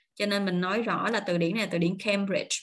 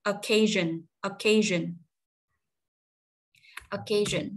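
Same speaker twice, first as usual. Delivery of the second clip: "Occasion" as uh-KAY-zhun, stress on KAY; three measured model takes of "Occasion", falling at the end; weight the KAY in "Occasion"; second-syllable stress; slower than usual. Shown as a demonstration. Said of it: "tại vì chỗ này dấu nhấn nè".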